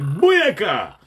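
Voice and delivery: loud mock cheer; excited exclamation. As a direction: call it out loud and high. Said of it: "He said".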